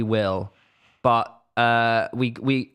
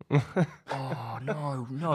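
much softer, hushed dismay; mock dismayed nurses; 8 dB quieter, much slower than usual. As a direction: murmur in shock at the X-ray. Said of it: "looking at it going".

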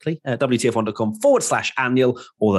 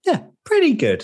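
pitched way up, sing-song; high mock echo. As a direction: squeak it back teasingly. Host echoes with high mock tone.

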